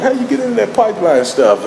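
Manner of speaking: high-pitched retold question; eager curiosity. Asking a man about his business, eager curiosity. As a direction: pipe up, keen to find out.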